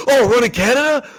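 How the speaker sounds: high, loud, startled blurt; hammed-up shocked gasp at the play call; mock surprised. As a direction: overreact like the secret slipped out. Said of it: "you've gotta make sure you're not like".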